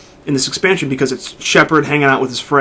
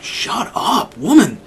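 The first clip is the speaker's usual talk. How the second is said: dismissive retort; 8 semitones higher, much slower than usual. Retold or imitated. Player retorts to nagging Ashley, mock dismissive. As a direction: shut her down with a scoff. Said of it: "And you're just like".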